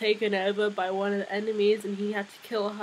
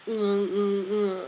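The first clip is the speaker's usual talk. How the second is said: hoarse throat clearing; slower than usual. Real, not acted. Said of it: "I sound like Donald Duck".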